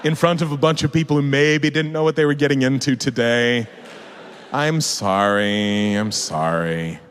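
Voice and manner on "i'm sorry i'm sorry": very slow, drawn-out, lowered apology; sheepish apology. Real, not acted. Speaker apologizing to a bunch of people, sheepish. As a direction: stretch it out, sinking lower.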